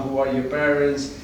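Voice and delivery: usual speaking voice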